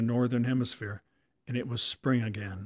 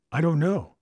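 ordinary delivery

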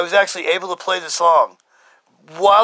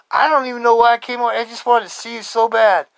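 retold excitement; 6 semitones higher than usual. Friend explaining why he came, excited. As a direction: blurt it out with raised pitch.

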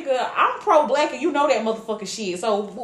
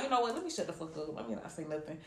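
soft, lowered, backing off; resigned self-talk; 4 semitones lower, 15 dB quieter, faster than usual. Retold or imitated. Woman deciding to keep quiet with her man, resigned. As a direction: drop it to a quiet note to self. Retold talking herself into silence.

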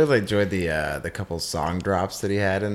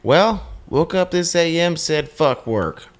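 theatrical ad read; 6 semitones higher, 5 dB louder than usual. Acted. Host reading a Richmond post about skipping work, loudly performed. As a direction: belt out the post with stretched words.

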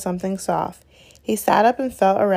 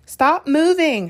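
higher, slow, commanding; sharp order; 7 semitones higher, 4 dB louder, slower than usual. A narrator's reading. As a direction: bark the order to freeze. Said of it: "Hermione ordered".

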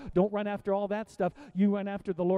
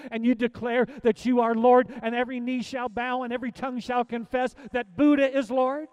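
normal talking voice

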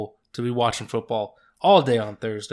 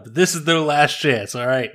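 ordinary talk, nothing marked

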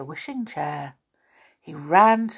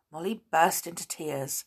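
usual speaking voice

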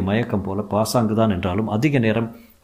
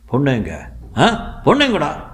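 aggressive shout; 6 semitones higher than usual. A narrator's reading. James shouting at the thug for the woman, building to fury. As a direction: hammer the question until you explode. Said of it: "ஆக்ரோஷமாக கத்தினார் James".

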